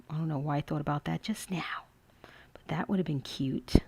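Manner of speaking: hushed aside, whispered; a soft whispered afterthought. Real, not acted. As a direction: drop to a whisper as if thinking aloud. Musing aside while crafting.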